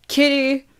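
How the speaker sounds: high, loud summons; scream. A narrator's reading. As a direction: yell for your sister in another room. Calls sister again as bell rings.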